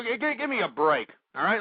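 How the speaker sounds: ordinary talk, nothing marked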